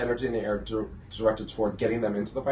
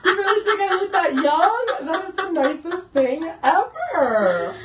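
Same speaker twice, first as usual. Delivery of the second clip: very high, loud, laughing gush; delighted squeal; 7 dB louder, much slower than usual. Real, not acted. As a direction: squeal thanks through laughter. Squeals at compliment on looking young.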